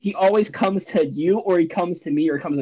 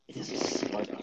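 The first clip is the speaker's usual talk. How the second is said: whispery raspy announcer voice; 14 dB quieter than usual. Shown as a demonstration. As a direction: rasp it out like a trailer voice. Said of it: "like, a raspy voice".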